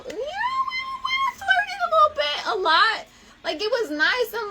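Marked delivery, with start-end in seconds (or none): 0.1-3.1 high squealing giggly voice; giddy squeal. Real, not acted. Giddy about flirting.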